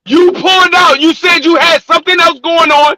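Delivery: high, heated, emotional accusation; real yelling. Real, not acted. Debater cutting off his rival's explanation, yelling. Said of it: "quit yelling and get out of your feelings".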